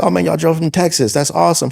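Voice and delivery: imitating an excited promoter. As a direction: gush over them like a hyped host.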